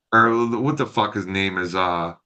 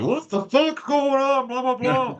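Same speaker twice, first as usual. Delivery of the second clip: mock outrage; 13 semitones higher than usual. Players find the seats taken, mock outrage. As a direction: mimic a whole team up in arms. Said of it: "up in arms".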